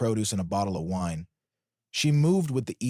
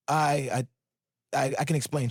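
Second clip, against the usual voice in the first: nervous stammer, pitched up; stuttering; 5 semitones higher than usual. A narrator's reading. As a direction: stumble over the first words. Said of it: "she stuttered".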